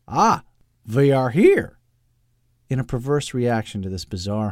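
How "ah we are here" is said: put-on high, loud, thick foreign accent; Doctor Loeb's thick accent. A narrator's reading. Eccentric doctor announcing arrival, loud thick accent. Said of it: "his Doctor Loeb performance".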